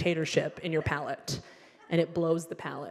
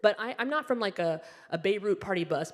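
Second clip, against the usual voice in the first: ordinary talk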